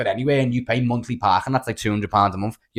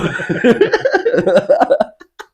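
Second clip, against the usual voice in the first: much higher, loud laughter; high laughing fit; 11 semitones higher, 5 dB louder than usual. Real, not acted. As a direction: crack up. After the quote.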